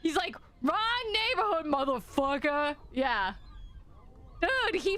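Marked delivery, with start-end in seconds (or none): 0.6-2.8 high, mock-tough taunt; territorial tough gull. Viewer voicing the angry gull, mock-tough threat. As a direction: play a bird defending its turf.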